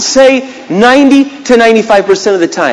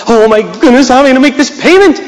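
worried, rushing the question; mock panic; 6 semitones higher than usual. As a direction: fret over the bill, hammed-up. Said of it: "I get all worked up".

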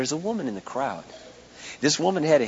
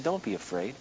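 reassuring; 4 semitones lower, 9 dB quieter than usual. Retold or imitated. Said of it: "Jesus looked at him and said".

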